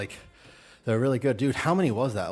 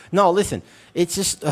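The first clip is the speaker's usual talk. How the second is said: loud; 8 semitones higher, 8 dB louder than usual. Real, not acted. Defending himself, loud protest.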